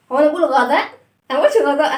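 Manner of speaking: أنا and قلتش stressed loudly, questioning; teacher correcting children's reading. Shown as a demonstration. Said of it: "teacher correcting a wrong reading".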